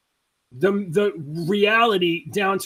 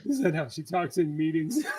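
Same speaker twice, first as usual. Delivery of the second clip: softer laughing repeat; 3 semitones lower, 8 dB quieter than usual. Retold or imitated. Repeats quote, still laughing.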